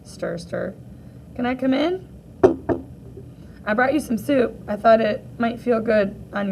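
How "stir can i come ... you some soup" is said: ordinary delivery, nothing marked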